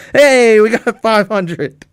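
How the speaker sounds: high, loud, drawn-out cheer; excited cheer. Real, not acted. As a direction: celebrate the milestone. Celebrating reaching five hundred.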